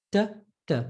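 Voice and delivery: usual speaking voice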